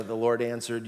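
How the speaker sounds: ordinary delivery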